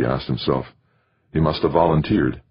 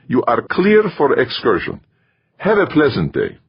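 put-on higher-pitched Middle European accent; 9 semitones higher, 3 dB louder than usual. A narrator's reading. Safety officer announcing herself to a colleague, Middle European accent. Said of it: "in her Middle European accent".

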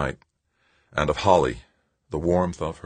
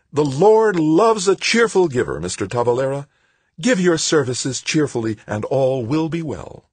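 smiling piety; 10 semitones higher, 5 dB louder than usual. A narrator's reading. Smiling woman to unwilling Tavalera, sweetly pious. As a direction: preach at him through a fixed smile. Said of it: "Still smiling, the woman said".

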